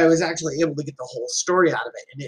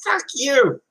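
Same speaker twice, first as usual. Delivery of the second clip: raised-pitch jab at the biker; mock angry retort; 8 semitones higher than usual. Imagined comeback to the motorcycle man, mock angry. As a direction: snap it back with a sharp high edge. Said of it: "I could have gone".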